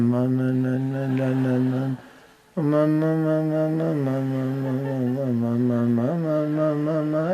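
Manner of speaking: "ma, ma, ma" run together in a soft mindless mumble; mumbled ma ma. Retold or imitated. Teacher mocking careless chanting, soft droning "ma". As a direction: drone "ma, ma, ma" with no end syllable. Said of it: "we just keep on saying".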